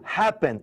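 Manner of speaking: "happen" stressed on HAP; HAP-pen, second syllable light; stress on first syllable. Shown as a demonstration. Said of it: "é a primeira".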